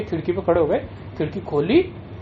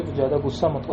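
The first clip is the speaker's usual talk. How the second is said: low, soft and unhurried; calm reassurance; 6 semitones lower, 5 dB quieter than usual. Retold or imitated. Guru soothing the furious disciple, lower and softer. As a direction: settle him down gently.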